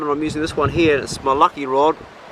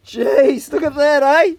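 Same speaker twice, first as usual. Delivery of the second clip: excited; 11 semitones higher, 6 dB louder, slower than usual. Real, not acted. Admiring the finished fix.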